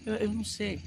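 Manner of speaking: weeping plea that he can't go on without her; acted crying. Shown as a demonstration. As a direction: let the voice break as if sobbing. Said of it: "tá fingindo chorar".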